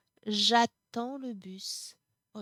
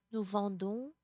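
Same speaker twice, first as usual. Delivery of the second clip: ordinary delivery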